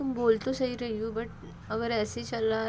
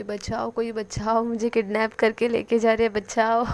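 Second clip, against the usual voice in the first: yelling for help; 8 dB louder than usual. A narrator's reading. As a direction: scream for help as if kidnapped. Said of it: "ज़ोरों ज़ोरों से चिल्लाने लगती है".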